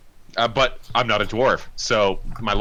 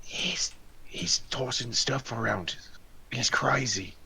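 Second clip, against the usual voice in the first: hushed in-character report; whisper; 4 semitones higher, 7 dB quieter, slower than usual. Acted. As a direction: whisper it to your sneaking companions. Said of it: "he's just gonna whisper".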